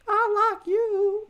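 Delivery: very high, slow breathy-sweet mimic; mocking her voice. Mocking the judge's sweet talk, very high and slow. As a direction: stretch it out high and syrupy. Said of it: "she's like".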